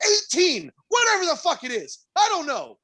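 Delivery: high-pitched retold tirade; berating the cook in the retelling; high exasperated scolding.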